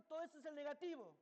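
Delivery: rattled off quickly at normal volume; quick statement. Real, not acted. Teacher explaining the negative part to students, quick statement. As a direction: say it fast, run the words together. Repeats the same words for emphasis.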